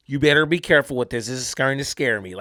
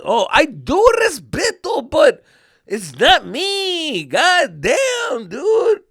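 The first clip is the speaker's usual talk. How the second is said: loud, high, drawn-out bawling; hammed-up crying shout; mock crybaby; 10 semitones higher, 6 dB louder, much slower than usual. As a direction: bawl in protest, stretching the words. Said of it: "I was freaking Mister Crybaby".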